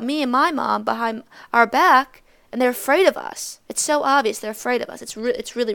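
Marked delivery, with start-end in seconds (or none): none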